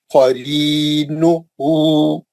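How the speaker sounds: slow, drawn-out Quranic recitation. Shown as a demonstration. Teacher recites word slowly for class. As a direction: chant each syllable slowly for students. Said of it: "नून पे पेश है एक हरकत".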